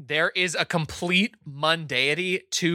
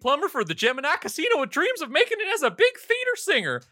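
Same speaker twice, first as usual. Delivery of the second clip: high puppet voice; 12 semitones higher, faster than usual. Acted. Puppet introduces himself.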